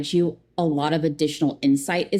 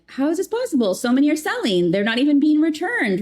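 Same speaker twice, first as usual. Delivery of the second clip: retold disbelief, pitched much higher throughout; exasperated disbelief; 8 semitones higher than usual. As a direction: ask it like you truly can't believe it. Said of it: "even though I'm like".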